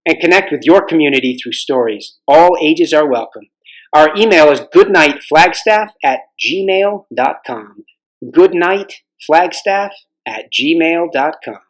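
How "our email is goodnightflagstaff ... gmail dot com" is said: slow dictation. Real, not acted. Dictating email address twice.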